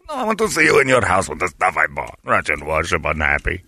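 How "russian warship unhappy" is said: low, softer mock sulk; sulky warship. Host as the warship, low sulky mock voice. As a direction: grumble like a spurned robot ship.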